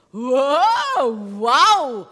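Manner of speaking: loud, thrilled exclamation; full-voiced delight; amazed wow. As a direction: marvel at walking on water. Peter walks on water.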